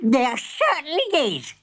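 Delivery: high, indignant granny; shrieking granny. Acted. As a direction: shriek your outrage. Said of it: "she shrieked".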